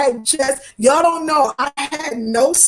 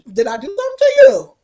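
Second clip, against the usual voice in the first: indignant retort; 7 semitones higher, 5 dB louder, faster than usual. Retold or imitated. Retelling her reply to an angry woman.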